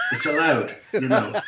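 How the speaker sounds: loud laughing declaration; laughing shout. Real, not acted. Caller explaining Irish swearing with a laughing shout. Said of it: "when we use the F word".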